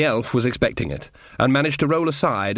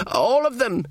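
weakly; 11 semitones higher than usual. A narrator's reading. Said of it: "said Sean weakly".

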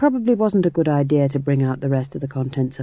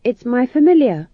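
higher and louder, on the defensive; young witch bristling at criticism; 10 semitones higher, 7 dB louder than usual. A narrator's reading. Magrat defending her pet to Granny. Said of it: "she said defensively".

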